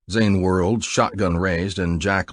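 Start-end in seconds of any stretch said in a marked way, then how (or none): none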